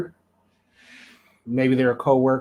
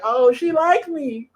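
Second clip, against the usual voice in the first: sudden realization; 12 semitones higher, slower than usual. Retold or imitated. Realizing a girl liked him.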